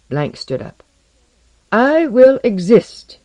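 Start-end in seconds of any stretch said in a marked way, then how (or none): 1.7-3.2 high, loud, slow proclamation; firm declaration. A narrator's reading. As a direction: declare it firmly, weighting each word. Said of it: "he stated firmly".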